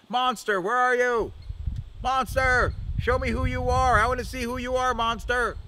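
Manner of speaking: high, loud taunting call; high cocky shouting challenge; Crow yelling. Acted. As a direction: call out to the monster across the beach. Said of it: "walking around along the beach yelling".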